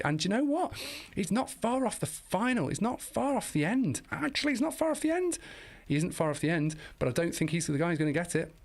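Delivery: fast, high, rising sprint commentary; breathless high-pitched finish-line commentary; excited race call. Real, not acted.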